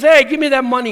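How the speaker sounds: loud mock threat to a buddy who owes money; demanding. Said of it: "and says".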